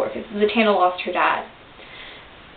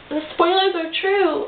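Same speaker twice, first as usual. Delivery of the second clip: ordinary talk, nothing marked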